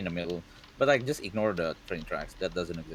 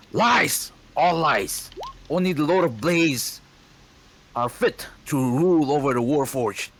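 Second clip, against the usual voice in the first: high loud fanatical yell; furious shout; 7 semitones higher, 7 dB louder than usual. Acted. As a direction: roar down the lies. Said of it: "the Warforged is like".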